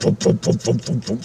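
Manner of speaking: voiceless, rhythmic helicopter thumps; mouth-made helicopter rotor noise; imitated rotor thump. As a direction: puff out steady chopper-blade beats.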